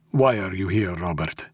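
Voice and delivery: put-on measured Russian-accented question; interrogating Russian boss. A narrator's reading. Mob boss questions his captive, measured.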